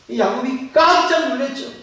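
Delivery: ordinary talk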